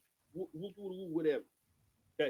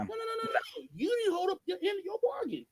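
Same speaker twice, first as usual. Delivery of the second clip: much higher, insistent no no no; emphatic scolding; 10 semitones higher than usual. Real, not acted. Speaker rebuking non-voters for breaking their bargain, high and insistent. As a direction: scold them for breaking the deal. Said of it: "scolding those who broke the deal".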